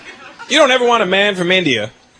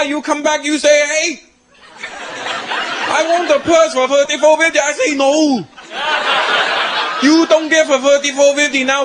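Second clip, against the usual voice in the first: mock Chinese shopkeeper; 8 semitones higher than usual. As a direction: preach thrift, pitched way up. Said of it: "He starts telling me stuff".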